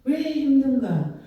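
ordinary talk, nothing marked

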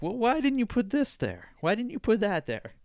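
high-pitched mock complaint; complaining listener. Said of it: "you're gonna be like".

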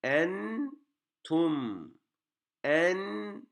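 "Entum" with a long high en, then tum; "Entum" drawn out in two separate syllables; pausing on nun and mim. Shown as a demonstration. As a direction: hold both the n and the final m of "Entum". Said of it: "hem ende duruyoruz".

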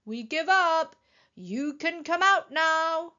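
high, loud, slowing call. A narrator's reading. Elmer tells hidden Wilbur the game is over, calling out. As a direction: sing it out to someone hiding. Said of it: "called Elmer".

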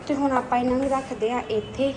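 usual speaking voice

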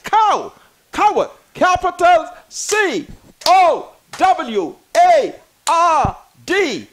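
"Coward" spelled letter by letter, slow and loud; spelling it out. Shown as a demonstration. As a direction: spell out C O W A R D slowly and emphatically. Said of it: "I can spell coward".

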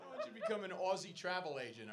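ordinary talk

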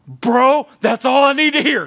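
sudden resolve, ready to go; fired-up friend. Retold or imitated. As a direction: friend on the phone, all fired up.

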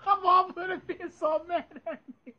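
high wheezing laugh fading out; helpless laugh. Real, not acted. Vlogger cracking up at the church story, wheezing laugh. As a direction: laugh till it hurts, high and breathless. Cracking up over imagined scene.

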